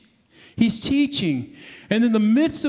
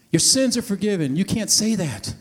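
usual speaking voice

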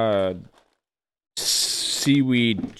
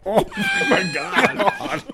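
high squeal of laughter; laughing; much slower than usual. Real, not acted. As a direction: crack up mid-word. Cracking up after tasting.

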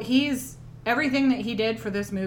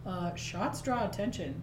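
ordinary talk, nothing marked

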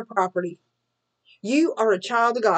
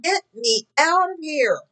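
higher-pitched plea, not truly shouted; half-screamed mock cry for rescue; 6 semitones higher than usual. Believer to God in the middle of a trial, mock screaming. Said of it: "you're screaming to God".

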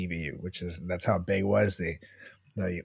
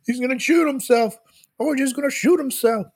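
panicked onlooker; 14 semitones higher than usual. Retold or imitated. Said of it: "he's like".

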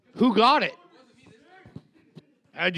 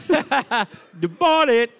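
mock English accent; 3 semitones higher, 4 dB louder, much slower than usual. Joking in put-on accent.

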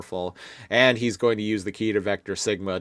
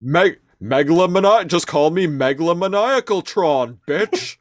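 cocky villain voice; 8 semitones higher, 5 dB louder than usual. Pretend. Mock Megatron boast.

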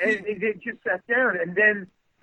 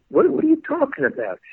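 retold bafflement; 10 semitones higher, 3 dB louder than usual. Acted baffled reply to frantic friend.

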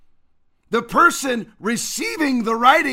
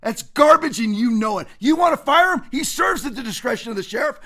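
ordinary talk, nothing marked

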